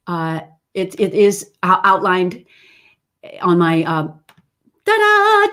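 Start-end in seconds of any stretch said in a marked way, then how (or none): 4.8-5.5 high sing-song ta-da; light showy flourish; playful fanfare. Pretend. Teacher unveiling her singer set, in a playful fanfare.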